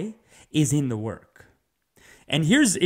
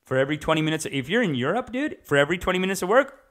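ordinary talk

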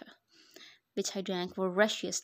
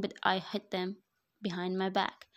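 ordinary talk, nothing marked

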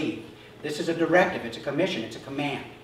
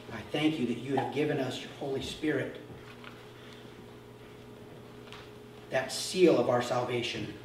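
soft, lower, reverent prayer voice; closing prayer; 3 semitones lower, 7 dB quieter than usual. Acted. Pastor leading the congregation in a closing prayer, hushed reverent. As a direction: pray quietly over the church.

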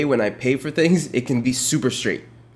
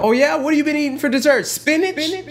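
sarcastic disbelief; 12 semitones higher than usual. Real, not acted. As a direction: ridicule his dessert taste. Sarcastic incredulous reaction.

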